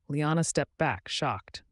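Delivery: usual speaking voice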